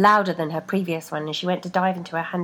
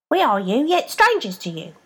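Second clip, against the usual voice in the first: higher and louder strange voice; eerie foot voice; 6 semitones higher, 6 dB louder than usual. A narrator's reading. Feet answering the woman, eerie higher voice. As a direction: cryptic and otherworldly. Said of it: "Just one voice this time".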